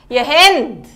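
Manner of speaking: loud call across the house. Acted. Stepmother calling Hend to come down, loud. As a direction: call her name down the stairs.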